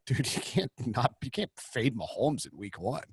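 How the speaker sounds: low, soft, slowed-down dude voice; average gambler voice. Acted. Host voicing the gambler in his head, low imitation. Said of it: "average gambler voice in my head is like".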